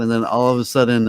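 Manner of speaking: usual speaking voice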